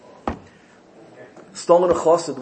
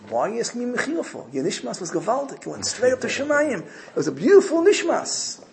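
higher, fast, enthusiastic rebbe; delighted praise; 8 semitones higher than usual. Retold or imitated. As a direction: gush over the beautiful Nishmas. Rebbe praises the screamed Nishmas.

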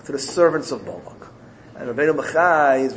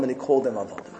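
usual speaking voice

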